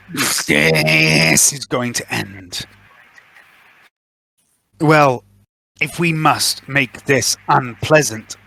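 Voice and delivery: slow, measured villain voice; a cool, patient threat; calm menacing villain. Acted. GM voices a villain over comms.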